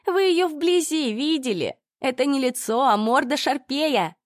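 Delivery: high, slow sneering jeer; mocking insult. A narrator's reading. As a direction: drawl out the insult with scorn. Ольга оскорбляет соседку. (язвительная насмешка)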